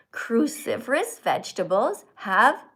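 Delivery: slow, careful read-aloud. Shown as a demonstration. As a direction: take each word slowly. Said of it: "don't go fast".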